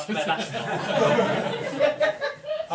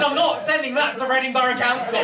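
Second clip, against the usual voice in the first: exasperated refusal; 6 semitones higher, 6 dB louder than usual. Retold or imitated. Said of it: "I said".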